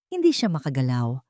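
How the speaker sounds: plain reading voice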